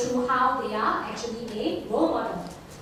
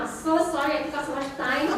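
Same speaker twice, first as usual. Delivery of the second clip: ordinary talk, nothing marked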